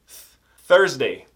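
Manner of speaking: Thursday with a clear th at the start; slowly, tongue between the teeth on the th of Thursday; teacher stressing th. Shown as a demonstration. Teacher shows students the th sound in Thursday, slow and clear. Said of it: "O quinta tem o T H".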